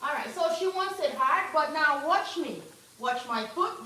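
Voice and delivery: loud and pumped up; hyped up. Real, not acted. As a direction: get fired up to scrub. Hypes herself up for scrubbing.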